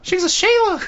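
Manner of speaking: high squeaky Aussie echo; falsetto Australian accent. Pretend. Echoing the co-host about the dog in falsetto Australian. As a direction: repeat it in silly Australian falsetto. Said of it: "we also try to do it in falsetto".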